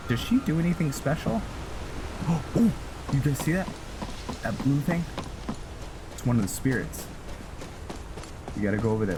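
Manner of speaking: ordinary delivery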